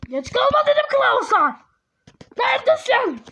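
loud, high-pitched parental yelling; angry mock-parent call; scolding shout. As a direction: yell after a late child. Parent yells for late child.

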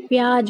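प्याज said "pyaaj", ending in j; wrong j ending. Shown as a demonstration. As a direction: close प्याज on j, no z. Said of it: "उसका गलत उच्चारण होता है".

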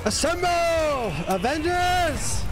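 battle cry. Acted. Rallying cry at boss fight.

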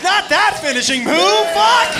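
high, loud mock dismay; horrified protest. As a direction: cry out as if it went badly wrong.

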